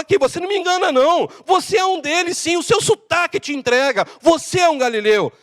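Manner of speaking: high, rapid-starting accusation; acted insistent finger-pointing; accusing. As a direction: catch him out and expose him. Man acts out exposing Peter.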